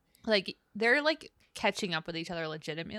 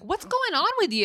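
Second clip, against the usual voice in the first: high, bright eager question; eager friend; 12 semitones higher, 5 dB louder than usual. Retold or imitated. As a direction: pitch it up, keen to hear. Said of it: "he literally is like".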